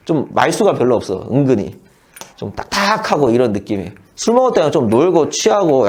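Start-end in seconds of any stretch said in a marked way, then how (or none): none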